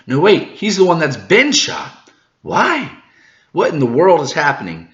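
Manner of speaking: shocked outcry. Acted. Vinsmoke family shocked by the gunshot, alarmed outcry. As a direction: react like the plan just broke.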